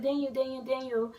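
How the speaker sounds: high, repeated fangirl name-call; excited calling out. Retold or imitated. Fan retelling how she greeted Daniel, mildly excited high call.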